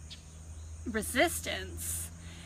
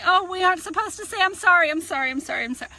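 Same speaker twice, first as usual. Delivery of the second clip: high, loud, rushed string of sorries; comically over-apologize in a panicky spiral; mock flustered apologizing; 7 semitones higher, 8 dB louder, faster than usual. Imitating women apologizing for apologizing.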